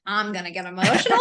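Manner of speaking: laughing through a confession of being moved; half-laugh, giving in to the feeling; giggling as the tears win; laughing admission. Retold or imitated. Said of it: "but then I was like".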